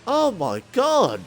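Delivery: real shock at the spin; excited yelp. Real, not acted. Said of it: "me saying she's around again".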